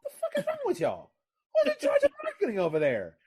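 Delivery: very high-pitched, loud outrage; exasperated high yell; pissed off, voice going high. Real, not acted. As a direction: demand answers in a squeaking-high voice. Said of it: "My voice gets a little high when I get a little pissed off".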